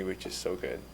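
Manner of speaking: ordinary talk, nothing marked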